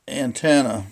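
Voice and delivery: ordinary talk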